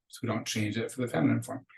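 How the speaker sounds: ordinary delivery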